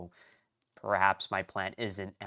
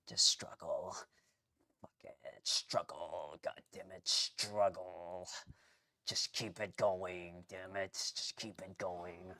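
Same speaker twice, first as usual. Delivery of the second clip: grim self-urging; 2 semitones lower, 7 dB quieter, slower than usual. Real, not acted. Streamer tells himself to keep struggling, muttered grimly. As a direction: mutter it slowly to yourself, pushing through.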